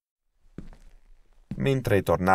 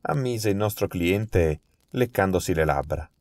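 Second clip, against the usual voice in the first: ordinary delivery, nothing marked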